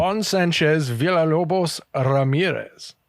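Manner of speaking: put-on slow rolled Scottish accent on Juan Sanchez Villalobos Ramirez; a Scottish brogue with a rolled Spanish name. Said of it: "I like how he's got a Scottish accent, but he rolls it".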